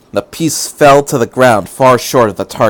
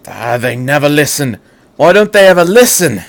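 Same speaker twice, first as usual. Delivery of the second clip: rising to higher, louder exasperated grumble; exasperated old doctor; 4 semitones higher, 5 dB louder than usual. Acted. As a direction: complain about those who never listen. Doctor complaining.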